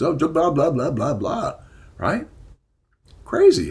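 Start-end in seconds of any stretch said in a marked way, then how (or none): 0.0-1.5 mocking drone of blahs; dismissive blah blah. Speaker mocking toxic masculinity talk, dismissive. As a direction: wave off the jargon with a sneer. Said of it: "these toxic masculinity things".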